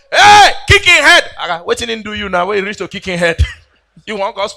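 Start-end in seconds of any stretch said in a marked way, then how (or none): 0.1-1.2 loud, slow drawn-out yell; mock coach bellowing; coach shout. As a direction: stretch the call out across the field. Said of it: "they shout".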